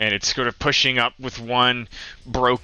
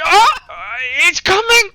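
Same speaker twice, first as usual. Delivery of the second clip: very high, loud panicked yelling; panicked shouting; 9 dB louder, faster than usual. Acted. Erky sees the tree coming at him, panicked shouting. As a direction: yell a frantic warning in a high voice. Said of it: "you can hear him shout".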